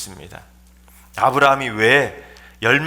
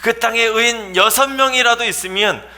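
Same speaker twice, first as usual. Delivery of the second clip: high, urgent question to God; insistent appeal; 8 semitones higher than usual. Acted. Imagined Abraham bargaining down to six, high insistent appeal. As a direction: press the case further. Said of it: "거기까지 물어봐야 되지 않겠습니까".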